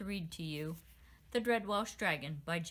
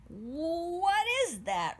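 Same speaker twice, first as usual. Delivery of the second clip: high, slow, louder alarm; exclaimed; 8 semitones higher, 5 dB louder, much slower than usual. A narrator's reading. Sprite prince pointing at the commotion, high slow exclaim. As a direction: gasp it out at the thing. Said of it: "Royce exclaimed".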